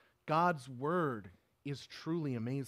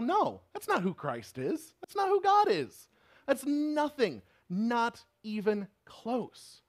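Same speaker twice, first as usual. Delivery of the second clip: loud, high-pitched cry of refusal; emphatic rebuke; 9 semitones higher, 7 dB louder than usual. Acted. Preacher telling the devil no, in a raised, emphatic shout. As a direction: shout it down forcefully, drawing out the ending.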